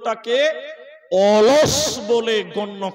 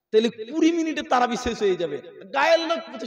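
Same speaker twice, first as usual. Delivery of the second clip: ordinary delivery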